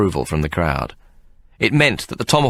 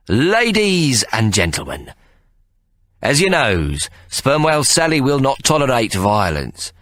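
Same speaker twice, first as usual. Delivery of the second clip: slow, raised announcer opening; grand announcement; 6 semitones higher, slower than usual. Acted. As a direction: announce it to the room. Ikey announcing to crowd.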